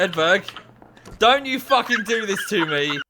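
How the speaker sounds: angry at teammate. Real, not acted. Gamer yells at teammate Edberg, loud and furious. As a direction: yell at him to not ruin this.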